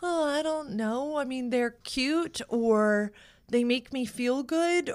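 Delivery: slow, high dithering voice; vague. Retold or imitated. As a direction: drawl it out, unsure and dreamy. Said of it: "we're like".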